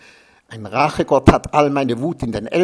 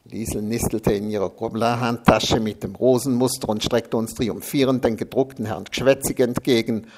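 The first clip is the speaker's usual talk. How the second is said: ordinary delivery, nothing marked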